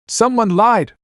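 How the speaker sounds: ordinary talk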